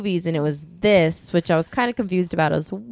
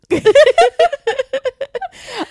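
high burst of laughter; 7 dB louder than usual. Real, not acted. Joking about French accents.

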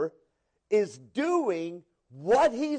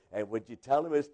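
ordinary talk

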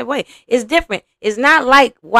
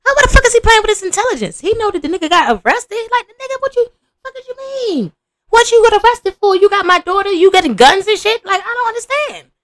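what he should say; 11 semitones higher than usual. Pretend. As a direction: play the furious dad demanding answers. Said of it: "He said".

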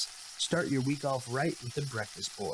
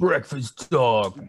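slow, louder, croaky mock crack; imitating a cracking voice; 11 dB louder, much slower than usual. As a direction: ham up a voice that breaks. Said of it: "So he sounds like this".

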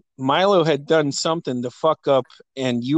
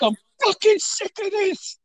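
high mock exasperation; imitating fed-up mom. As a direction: throw it up high like a fed-up mother.

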